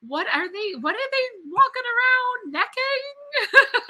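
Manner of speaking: high, slow puzzled question. Retold or imitated. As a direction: ask it baffled and amused, drawn out. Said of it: "And we're like".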